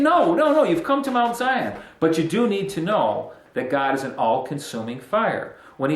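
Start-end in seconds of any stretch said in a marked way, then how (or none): 0.0-1.7 high, loud urgent no, no, no; emphatic reassurance. Retold or imitated. God corrects the frightened people, loud and urgent. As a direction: break in urgently to stop their fear. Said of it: "And he's saying".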